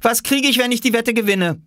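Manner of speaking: put-on very high child's voice, challenging; high, eager childlike question; child bargaining. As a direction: challenge back in a high kid voice. Children bargain over a bet.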